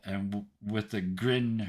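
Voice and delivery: ordinary talk, nothing marked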